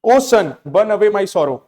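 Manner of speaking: drawn-out poetic appeal. Shown as a demonstration. Teacher reads apostrophe to sun, slow and lifted. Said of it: "बहुत अच्छा example".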